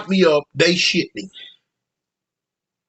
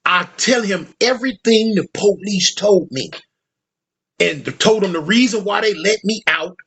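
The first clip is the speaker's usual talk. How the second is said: ordinary talk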